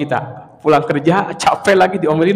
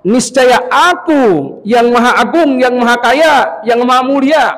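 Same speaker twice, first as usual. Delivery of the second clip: solemn quoted word of God; 4 semitones higher, 4 dB louder, slower than usual. Acted. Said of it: "Kata Allah".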